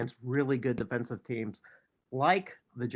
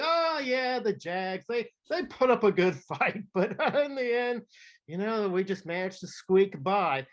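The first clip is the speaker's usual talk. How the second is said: high, mock-modest rival gloating; mock humble rival fan; 6 semitones higher than usual. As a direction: play the falsely modest winner. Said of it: "he's saying".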